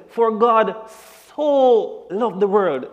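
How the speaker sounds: "so" stretched slow and loud in "For God so loved the world"; emphatic so. Shown as a demonstration. Preacher showing the congregation how so deepens love. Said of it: "So when God says".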